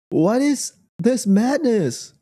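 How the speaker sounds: high, drawn-out disbelief; exasperated disbelief. Real, not acted. Vlogger reacting to a baffling video, exasperated disbelief. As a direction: stretch the words out in rising exasperation.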